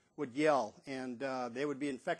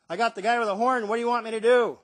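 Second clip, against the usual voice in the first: loud, raised radio-report voice; usher calling in; 6 semitones higher, 10 dB louder than usual. Retold or imitated. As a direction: call it into a walkie-talkie. Usher reports into walkie-talkie.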